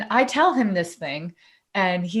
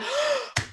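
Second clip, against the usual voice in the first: retold gasp; 7 dB quieter than usual. Reacting to his brother's story.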